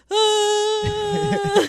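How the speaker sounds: high, loud drawn-out mock scream; imitated scream. Friend imitating them rolling down the hill, mock scream. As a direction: scream like you're rolling downhill. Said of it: "they're like".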